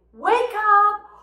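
high, loud playful shout; wake-up call. Shown as a demonstration. Teacher explaining the game to kids, loud wake-up shout. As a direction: rouse everyone with a big bright call. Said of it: "And when I say".

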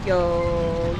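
usual speaking voice

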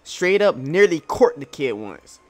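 loud, brash character voice; brash crew member. Acted. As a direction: read it like a cocky crewman. Reads more character lines.

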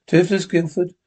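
ordinary talk, nothing marked

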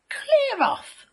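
very high piggy yell; rude shout. A narrator's reading. Rude little pig to the visiting wolf, shouting. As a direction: shout him away, high and loud. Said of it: "shouted the rude little pig".

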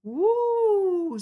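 high, loud whoop; impressed woo. Acted. Gecko cheers at kitty, high impressed whoop. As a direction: cheer her on.

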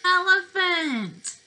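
high, loud, slow triumphant call; excited cheer. Acted. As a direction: announce it with big glee. Excited discovery for children.

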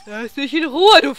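ordinary talk, nothing marked

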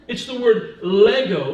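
normal talking voice

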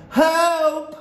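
high, loud cry for help; terrified scream. A narrator's reading. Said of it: "I screamed".

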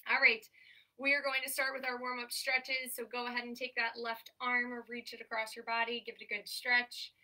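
ordinary talk, nothing marked

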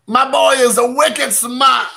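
put-on a jokey Boston accent on wicked smart. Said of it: "not even put on Boston accent".

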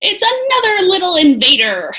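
put-on monk cheerfully greeting another child as an invader; jolly booming monk. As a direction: tease the newcomer cheerfully. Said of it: "boomed a deep, jolly voice".

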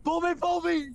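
high frantic shout; panicked yelling. Real, not acted. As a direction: yell for help in a panic. Panicked teammate yelling in game.

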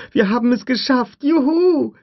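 put-on very high, loud child's whoop; joyful cheer. Lex cheers as they escape, very high joyful whoop. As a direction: cheer like a kid set free. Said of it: "rief Lex".

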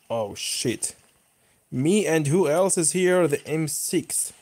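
ordinary talk

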